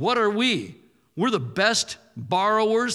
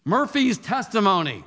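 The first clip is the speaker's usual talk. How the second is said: usual speaking voice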